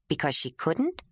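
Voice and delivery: usual speaking voice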